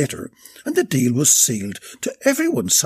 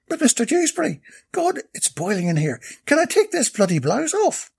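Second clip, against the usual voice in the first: put-on bright, eager young saleswoman pitch; sweet-talking Irish sales lass; high saleswoman voice; 11 semitones higher than usual. Maeve speaks again.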